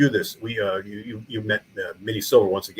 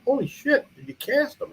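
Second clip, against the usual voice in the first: pitched way up, retelling his own excitement; a retold excited yelp; amazed exclamation. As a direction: shoot up high in amazed disbelief. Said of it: "I said".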